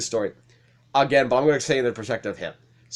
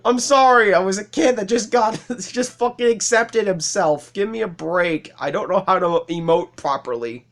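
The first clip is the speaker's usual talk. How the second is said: high, exclaimed excuse with a laugh; defensive apology; 7 semitones higher than usual. Pretend. Former worker begging the supervisor for a break, defensive. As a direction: plead your case, pitched well up.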